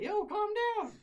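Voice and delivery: high, loud yell to stop him; shouting. Retold or imitated. Yelling at his swinging roommate to calm down. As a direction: bark it out over the brawl.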